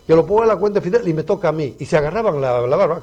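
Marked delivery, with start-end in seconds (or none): 0.1-1.1 quicker, louder, lightly put-on voice; imitating Fidel's followers. Next bearded diner answers the maître d', quick light imitation. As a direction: rattle off the mimicked line. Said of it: "imitando al gran Fidel".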